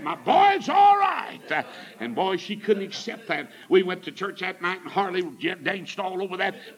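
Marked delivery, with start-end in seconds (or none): 0.0-1.6 high, loud, drawn-out cry of joy; triumphant preacher's shout; retold jubilant shout. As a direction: holler it slowly to the whole church. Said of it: "I'm shouting because I used".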